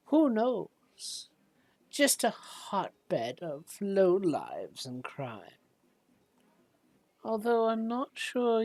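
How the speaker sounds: put-on soft, knowing old-lady informant; Olive Stillwater. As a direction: share underworld rumours softly, in an old shopkeeper's voice. Game master plays old shopkeeper.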